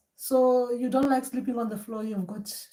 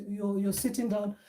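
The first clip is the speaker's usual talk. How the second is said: ordinary talk, nothing marked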